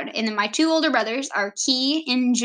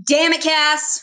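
loud, higher exasperated quote; punchy, raised-voice mock scolding; exclaimed catchphrase; 5 semitones higher, 4 dB louder, slower than usual. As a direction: call it out like a TV catchphrase. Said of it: "So it's always".

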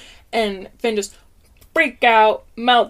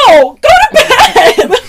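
much higher and louder, slow furious yell; exasperated shout; 18 dB louder, much slower than usual. Retold or imitated. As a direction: blast it out like a fed-up parent at bedtime. Retold yelling at son.

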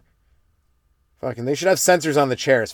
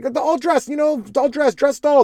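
frustrated repeating; 12 semitones higher than usual. Retold or imitated. Customer repeating order frustrated.

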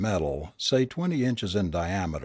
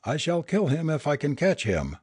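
higher pitch, understated storybook yell; mild shouted threat; 6 semitones higher than usual. A narrator's reading. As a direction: voice the threat on a raised pitch without really shouting. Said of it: "shouted Jagat".

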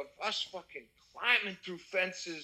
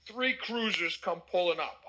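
ordinary talk, nothing marked

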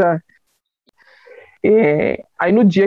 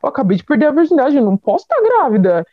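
retold mild panic, higher-pitched; nervous disbelief; 6 semitones higher, faster than usual. Newly pregnant girl reacting to the news, nervously incredulous. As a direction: protest in nervous bewilderment. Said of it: "eu tava muito nervosa".

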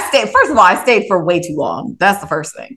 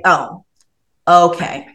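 usual speaking voice

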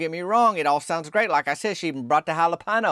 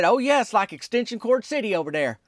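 excited answer; 7 semitones higher than usual. Retold or imitated. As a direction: gush it as an acted teammate. Myron's eager retold answer.

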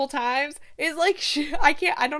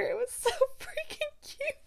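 high, softer squeal, almost crying; squealing with real delight; 13 semitones higher, 9 dB quieter than usual. Real, not acted. Gushing to viewers about a cute scene, squealing with tears. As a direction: voice cracks up high, overcome by how cute it is.

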